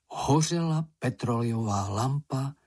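plain reading voice

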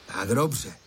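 plain reading voice

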